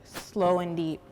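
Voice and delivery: loud, measured poetic delivery; poem recitation. Acted. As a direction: recite it line by line with weighty pauses. Poem recited before the welcome.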